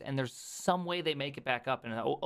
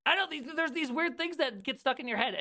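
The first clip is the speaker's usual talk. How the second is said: ordinary talk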